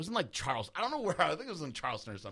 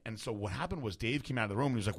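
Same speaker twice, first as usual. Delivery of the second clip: ordinary talk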